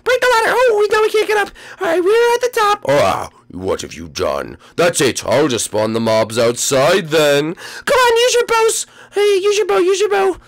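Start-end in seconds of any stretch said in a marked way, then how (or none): 0.0-2.8 very high, small character voice; squeaky cartoon voice. 7.5-10.4 very high, urgent little voice; squeaky cartoon voice. High character urges allies.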